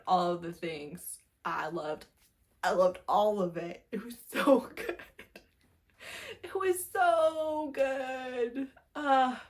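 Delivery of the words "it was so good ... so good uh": slow, higher, drawn-out swoon; dreamy gush over a book. Real, not acted. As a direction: melt over how good it was. Gushing over favorite book.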